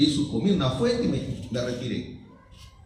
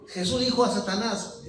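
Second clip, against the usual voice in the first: normal talking voice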